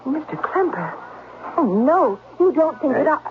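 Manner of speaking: a touch of panic, breaking off; pleading, backing away in fear; frightened plea. Acted. The governess pleads with Klemper to let her go.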